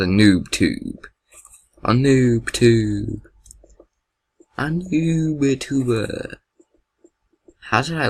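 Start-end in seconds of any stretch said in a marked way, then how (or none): 1.8-6.4 playful drawn-out naming. Real, not acted. Gamer showing off his noob tube, playful slow drawl. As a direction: stretch each word out slowly.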